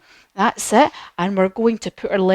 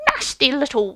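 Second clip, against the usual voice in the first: high, nasty little witch voice; pinched storybook-witch voice; playful witch; 5 semitones higher than usual. Pretend. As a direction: relish it like a gleeful witch. Demonstrates the witch pose.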